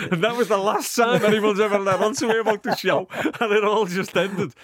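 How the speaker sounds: telling the punchline through laughter; laughing. Real, not acted. Laughs at his own punchline.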